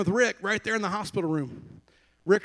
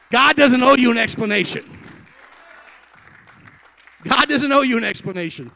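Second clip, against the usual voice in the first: much louder, higher preaching shout; emphatic shout; 6 semitones higher, 13 dB louder than usual. Real, not acted. Preacher correcting a proud listener, emphatic shout. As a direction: thunder it at the congregation. Preacher driving the point home.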